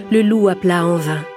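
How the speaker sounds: plain reading voice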